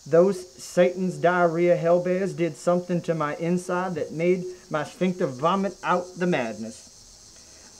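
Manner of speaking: ordinary talk, nothing marked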